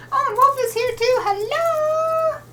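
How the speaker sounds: very high, delighted squeal; excited greeting. Real, not acted. Spots another viewer and greets them.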